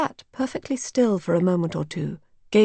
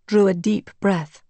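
usual speaking voice

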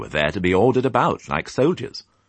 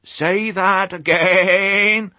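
very high, loud and slow astonished request; amazed sheep; 6 dB louder, much slower than usual. A narrator's reading. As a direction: stretch it out in sheer amazement. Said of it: "total amazement".

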